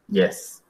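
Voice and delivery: YES low and level, unenthusiastic; neutral tone. Shown as a demonstration. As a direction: say YES noncommittally, neither rising nor falling. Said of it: "Neutral. Ni sí ni no". (YES en tono neutro, sin ganas)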